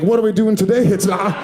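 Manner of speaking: eager pet dog in a mock German accent; cartoon German Shepherd, playful German accent. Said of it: "He's got a German accent".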